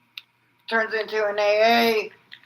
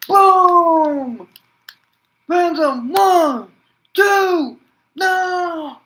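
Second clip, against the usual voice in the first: very high, very loud count ending in a cry of no; explosive play-by-play; 11 semitones higher, 9 dB louder, much slower than usual. Acted. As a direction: scream the near fall like a stunned fan. Calling the finish near fall.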